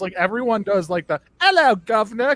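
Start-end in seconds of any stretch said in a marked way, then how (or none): 1.4-2.3 louder, exaggerated Cockney British accent; a cartoon British accent, hammed up; mocking the cliché British accent. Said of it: "trying to do British accents".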